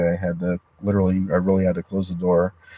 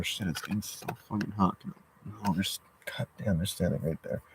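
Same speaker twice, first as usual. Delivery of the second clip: hushed; 11 dB quieter than usual. Real, not acted. Hushed, people standing right outside.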